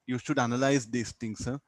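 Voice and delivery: usual speaking voice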